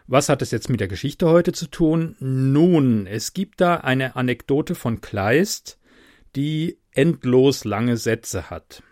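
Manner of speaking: usual speaking voice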